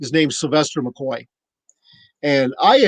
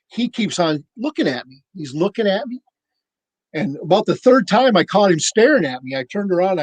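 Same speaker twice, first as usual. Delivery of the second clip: usual speaking voice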